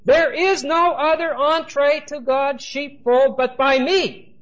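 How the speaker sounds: high, forceful proclamation as Jesus; emphatic declaration. Acted. Jesus confronting unbelieving Pharisees, emphatic.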